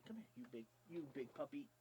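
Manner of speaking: quiet tender coaxing; hush-coax the puppy close; soft coaxing. Real, not acted.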